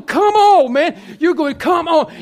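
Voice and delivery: high, loud, exasperated urging; urging shout. Acted. Preacher acting out a birth, loud urging. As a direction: shout it high as if pushing someone along.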